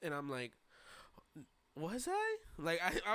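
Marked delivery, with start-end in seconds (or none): 1.8-2.4 high-pitched, confused question; mad confused. Retold or imitated. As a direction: play baffled, voice leaping up high. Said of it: "I sounded mad confused".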